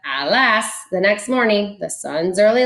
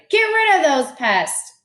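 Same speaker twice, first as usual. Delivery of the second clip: shouted; 9 semitones higher than usual. A narrator's reading. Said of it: "All Thidwick's friends shouted".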